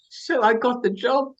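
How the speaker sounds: ordinary talk, nothing marked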